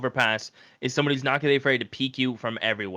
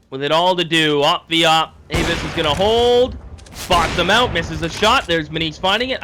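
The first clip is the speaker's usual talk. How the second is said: raised, tense esports call; live play-by-play; 5 semitones higher, 6 dB louder than usual. Real, not acted. Caster calls op v op to viewers, excited play-by-play. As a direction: call the duel loud and punchy.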